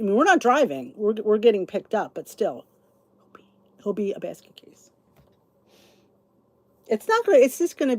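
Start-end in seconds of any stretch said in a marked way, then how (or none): 3.2-4.9 half-whispered gossip aside; conspiratorial whisper. Real, not acted. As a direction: lean in and whisper it. Gossiping aside about husband Steve.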